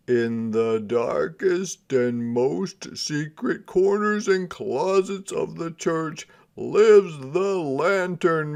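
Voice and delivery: hushed-then-rising ghost-story narration; spooky storytelling. A narrator's reading. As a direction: draw the kids into the dark.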